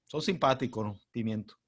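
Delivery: ordinary talk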